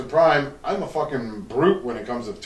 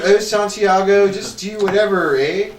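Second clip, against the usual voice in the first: higher, slow mock-Canadian wife; Canadian accent; 6 semitones higher, 4 dB louder than usual. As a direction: lightly put on a Canadian accent. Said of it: "'Cause she's Canadian".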